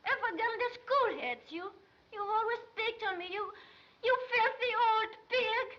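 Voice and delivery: defiant shouting at the accuser; furious outburst. Acted. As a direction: lash out, building to a loud insult. Cornered girl turns on interrogator.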